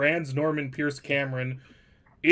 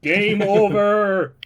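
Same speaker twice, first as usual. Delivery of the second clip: loud, high, long drawn-out announcement; big hammed-up "it's all over" cry; mock game-over shout; 6 semitones higher, 7 dB louder, much slower than usual. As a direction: bellow it out, stretching every syllable. Mock-ending the stream in protest.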